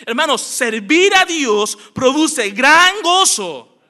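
loud, slow, high proclamation; full-force sermon peak, words stretched out; impassioned preaching. Real, not acted. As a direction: drive the climax home to the whole congregation. Climax of the sermon point.

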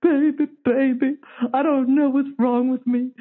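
a light mock of his begging; pleading ex. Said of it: "And he'd be like".

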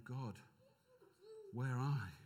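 low, hushed plea to God; quiet plea. Acted. Preacher voices Jesus searching for God, quiet plea. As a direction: call for God softly and low. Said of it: "But he's saying".

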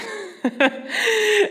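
bright high burst of laughter; a giggle at her own doubt. Real, not acted. Laugh after question.

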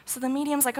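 normal talking voice

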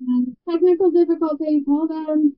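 muffled garbled mic; underwater. Real, not acted. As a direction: voice drowned by a bad connection. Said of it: "I didn't actually understand that".

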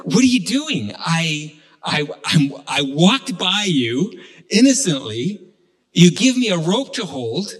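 stammering, incredulous storytelling voice; acted indignant disbelief. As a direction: protest as if unfairly landed with this. Acted protest at being handed rope.

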